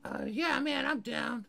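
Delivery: high-pitched tough guy voice; weirdo tough guy. Acted. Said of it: "weirdo tough guy".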